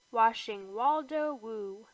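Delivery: plain reading voice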